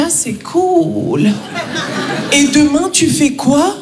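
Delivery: drawn-out, unimpressed reply; polite mock interest, stretched out; underwhelmed French friend. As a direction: imitate a French pal refusing to be impressed. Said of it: "j'annonce à un pote français".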